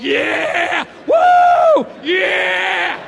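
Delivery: a body thrilled by sugar; sugar rush whoop. Acted. Comic acts his body cheering for junk food with a whoop. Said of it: "makes my sugar salt go like".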